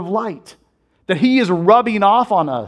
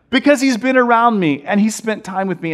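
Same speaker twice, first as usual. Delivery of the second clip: normal talking voice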